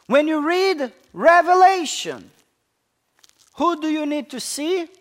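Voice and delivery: building preacher call. Acted. Climax of repeated questions.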